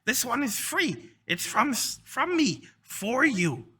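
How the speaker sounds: high, eager creature voice; generous kobold. Acted. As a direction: offer a gift in a high kobold voice.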